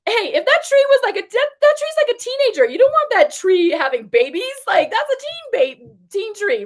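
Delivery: high, loud mock of the expert's voice; imitating the tree expert. Vlogger imitating the tree expert explaining teen trees, high and loud. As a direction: play the expert, amused and emphatic. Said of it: "He said to me".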